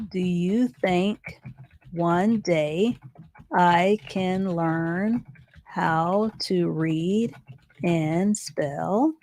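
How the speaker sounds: very slow, word groups spaced by pauses; dictation while writing. Shown as a demonstration. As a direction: spell out the sentence slowly, as if writing along. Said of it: "let me rewrite that sentence".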